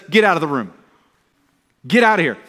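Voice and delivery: stern order out. Retold or imitated. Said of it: "This go says".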